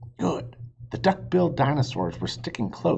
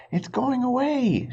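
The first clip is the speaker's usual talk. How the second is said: panting. A narrator's reading. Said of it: "she said, panting".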